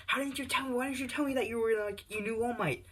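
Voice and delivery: high, shrill frantic questions; freaked-out mom. Acted. Izuku's mom hitting his shoulder in disbelief, frantic. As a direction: pester him in a panic.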